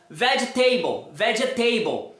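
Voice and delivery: slow "vegetable" ending in "table"; "vegetable" with a full AY in -able; wrong pronunciation. Shown as a demonstration. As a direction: say "vegetable" as veh-jeh-TAY-bol. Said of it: "pronunciam de forma errada".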